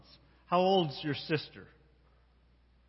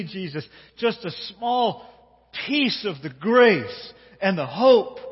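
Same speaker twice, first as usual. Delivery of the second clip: pleading prayer; 4 dB louder than usual. Acted. Voices a mother's prayer.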